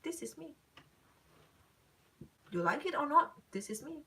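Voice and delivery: smirky imitation. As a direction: imitate his smug shrug of a line. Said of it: "He will be so smirky".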